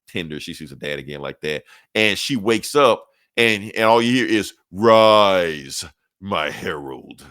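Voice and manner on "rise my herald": loud call then low, slow; deep godlike voice. Retold or imitated. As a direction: summon like an ancient god. Cosmic god's voice in retelling.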